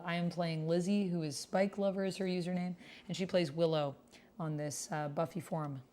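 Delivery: ordinary talk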